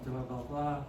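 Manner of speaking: ordinary delivery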